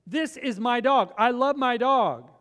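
slow, loud, offended insistence on the dog; a dog owner refusing to sell, offended and loud; highly offended. Retold or imitated. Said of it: "She was just highly offended".

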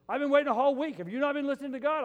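loud, high-pitched mock indignation; retold angry outburst. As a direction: act out a man storming in to confront his pastor. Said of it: "he was so mad".

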